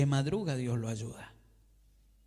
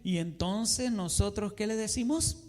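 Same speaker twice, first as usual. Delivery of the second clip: ordinary delivery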